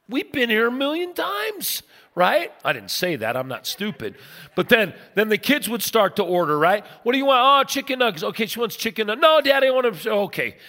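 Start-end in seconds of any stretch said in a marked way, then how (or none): 0.1-1.8 high-pitched comic exasperation; hammed-up exasperation, pitch way up; exasperated dad. Acted exasperated dad at drive-thru. 7.0-10.1 harried family ordering. Acted. Dad and kids ordering, acted.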